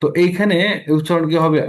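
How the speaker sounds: ordinary talk, nothing marked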